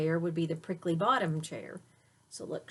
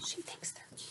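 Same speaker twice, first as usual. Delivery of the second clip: whisper; 12 dB quieter, faster than usual. Real, not acted. Aside to child during story.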